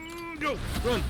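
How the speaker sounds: high, sharp panicked yell; panicked shout. Real, not acted. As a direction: scream at them to run. Panicked escape call.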